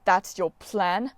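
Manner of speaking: slow, contemptuous question; a scornful god, slow and cold; angry disbelief. A narrator's reading. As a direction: spit it out after smashing the ukulele. Smashed his ukulele on the stones.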